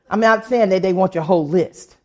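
usual speaking voice

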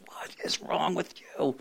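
soft, slow, breathy exasperation; exasperated aside. Retold or imitated. Pastor voicing his frustration with his daughter, slow and hushed. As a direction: let it out under your breath, slowly.

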